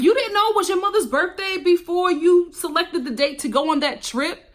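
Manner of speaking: high-pitched disbelieving comeback; incredulous retort. Acted. Acted incredulous retort to excuse.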